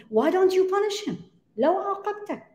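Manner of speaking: high-pitched imitation of egging someone on; retold urging. Bystanders urging the Khalifa to punish him, high-pitched prodding.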